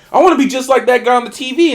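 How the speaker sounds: put-on high, loud, quick kid's wish; excited little kid. Young boy telling his dad his dream, excited. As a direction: blurt it out like an eager child. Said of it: "I tell my dad".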